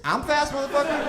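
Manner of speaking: high, offended shout of a comeback; indignant yell. Retold or imitated. Driver yelling at the homeless guy, high and indignant. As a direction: yell back, outraged.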